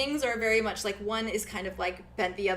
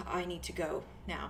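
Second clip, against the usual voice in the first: quiet exit line; 3 semitones lower, 7 dB quieter than usual. Acted. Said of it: "kinda is like".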